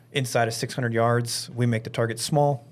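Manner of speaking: ordinary delivery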